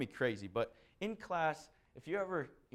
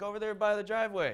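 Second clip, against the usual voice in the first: higher, louder call across the yard; 8 semitones higher, 7 dB louder than usual. Retold or imitated. Homeowner calling to the lawn worker. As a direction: point him there with a shout.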